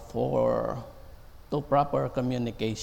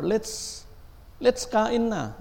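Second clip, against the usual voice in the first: put-on high, sing-song baby talk; 9 semitones higher, slower than usual. Parent calling a child to eat, high sing-song. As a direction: coo it to a toddler. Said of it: "talk to their babies like".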